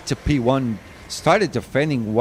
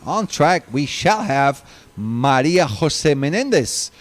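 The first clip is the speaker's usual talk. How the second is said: ordinary talk, nothing marked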